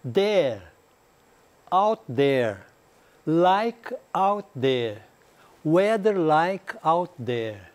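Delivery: slow build from the end, There then out there then like out there then weather like out there; back-chaining drill. Shown as a demonstration. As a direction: stretch each chunk very slowly so students can copy. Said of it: "a repetição de trás pra frente". (repetição de trás pra frente, bem devagar)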